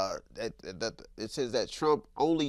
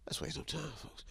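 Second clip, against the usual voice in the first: breathy hush of the intro line; whispered; 20 dB quieter than usual. Acted. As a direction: whisper it low.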